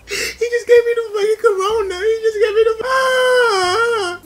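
high pitched mock wail; laugh-crying. Mock wailing about the sneeze.

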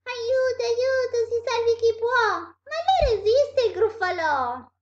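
high, loud cry for help; panicked. Acted. Mouse meets the monster it invented, panicked. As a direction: squeal in theatrical alarm.

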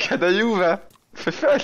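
high teasing call of the name; playful teasing. Real, not acted. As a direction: tease him with a singsong. (taquin, voix aiguë)

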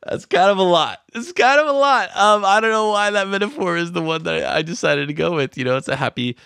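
high, loud, tickled voice squeezed by laughter; laughing through it. Real, not acted. Podcaster cracking up at own grim parachute metaphor, laughing high. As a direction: talk through a laughing fit.

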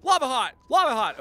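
high, loud chorus of calls; squealed imitation of excited chat; mock clamoring viewers. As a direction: shout contradictory hints in a frenzy. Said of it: "all that's gonna happen is".

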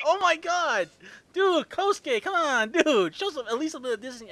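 high, pleading mock exasperation; playful high-pitched groan at a friend; exasperated plea. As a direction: beg him to show some dignity.

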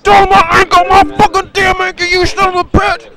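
high, loud hammed-up agony; play-acted shriek of pain, refusing to give in; screaming in pain. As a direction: wail over a wrecked ankle. Screaming over hurt ankle in play.

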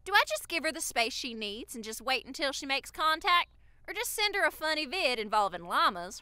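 lilting Southern read-aloud; Southern accent. Shown as a demonstration. Asking the fan's question, put-on Southern accent. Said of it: "read it in a Southern accent".

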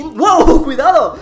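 loud laughing shout of alarm; startled laughing. Real, not acted. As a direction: laugh and yell a warning. Banana appears suddenly.

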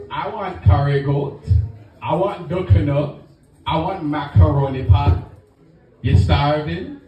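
lightly put-on Caribbean father listing food; light Caribbean accent, nagging questions; Caribbean dad. Caribbean father grilling the guest about food, light Caribbean accent. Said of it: "He kept going".

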